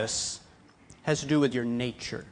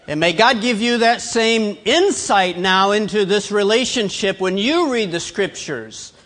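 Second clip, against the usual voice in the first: raised, louder blessing over the congregation; fervent preaching; 6 semitones higher, 6 dB louder than usual. Real, not acted. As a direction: press the blessing home from the pulpit.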